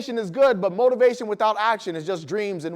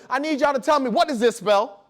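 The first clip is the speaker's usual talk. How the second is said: ordinary delivery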